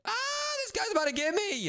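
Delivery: high, alarmed wail; panicked cry. Real, not acted. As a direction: cry out as you are nearly caught. About to be caught.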